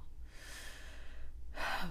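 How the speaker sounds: a quiet breathy sigh; soft sigh. Real, not acted. After admitting anger, a quiet tired sigh.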